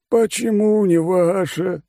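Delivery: high, slow, lazy condescending voice; bored rich glutton explaining terms; smug fat oligarch. Acted. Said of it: "искренне улыбнулся Чмо".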